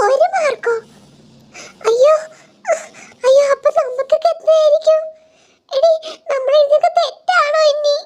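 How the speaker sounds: high, anxious little student voice; scared schoolkid. Acted. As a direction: fret like a kid who fears bad marks. Child student frets over marks.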